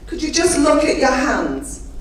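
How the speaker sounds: ordinary delivery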